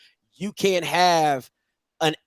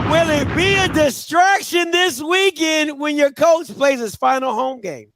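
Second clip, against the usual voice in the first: excited callout; 10 semitones higher, 4 dB louder, slower than usual. Real, not acted. Host calling out playful question.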